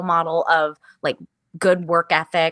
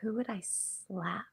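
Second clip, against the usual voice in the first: much softer, slow thoughtful murmur; quiet musing; 19 dB quieter, slower than usual. Real, not acted. Answering the interviewer's silly question, soft slow musing. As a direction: muse it under your breath, weighing the question.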